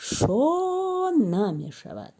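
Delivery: slow, drawn-out teacher blending; syllable blending demo. Shown as a demonstration. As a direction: stretch the syllables into one word. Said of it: "ду ҳиҷоро мо пайваст месозем".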